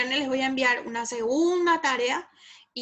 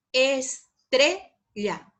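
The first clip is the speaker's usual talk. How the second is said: "estrella" in slow pieces, TRE punched; very slow "estrella", middle syllable loudest; prosodic stress; 4 dB louder, much slower than usual. Shown as a demonstration. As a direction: pause around a strong TRE in "estrella". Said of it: "esos son palabras con acento prosódico".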